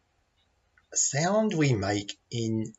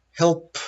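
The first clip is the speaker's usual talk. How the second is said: HELP with a puffing, repeated P at the end; stressed final P; 6 semitones higher, 4 dB louder than usual. Shown as a demonstration. Teacher trying ways to stress help, exaggerated final P. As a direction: pop the P of HELP out in puffs. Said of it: "Or perhaps I'd say".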